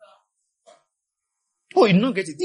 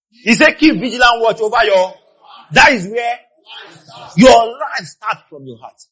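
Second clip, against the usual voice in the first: loud, raised preacher's voice; big, slow emphatic finish; full-force preaching; 5 semitones higher, 8 dB louder, slower than usual. Real, not acted. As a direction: drive the verse home to the congregation.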